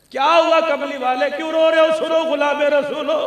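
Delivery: high, loud retold cry; dramatic sermon outcry; impassioned preaching. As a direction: cry out the question as a fervent preacher. Preacher cries out a quote.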